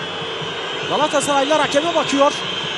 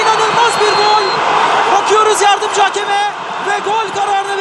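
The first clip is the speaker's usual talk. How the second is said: loud, high and fast call; screaming football commentator; 4 semitones higher, 3 dB louder than usual. Real, not acted. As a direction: shout the scramble, then the goal. Sudden goal after scramble.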